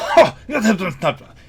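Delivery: loud, high scoffing "Ha!"; blustering. Acted. Gnome cook scoffing at his critic, blustering. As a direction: bluster out an indignant outburst. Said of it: "He sort of blusters and says".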